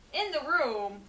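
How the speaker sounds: usual speaking voice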